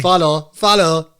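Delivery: mock Limerick twang; Limerick accent. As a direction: echo the word in a teasing Limerick accent. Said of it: "You sounded like a, a Limerick person there".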